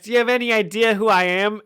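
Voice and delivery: high, fast, indignant gangster; tough gangster voice. Acted. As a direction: act the mobster pulling rank, quickly. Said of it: "they all talk like that in this scene".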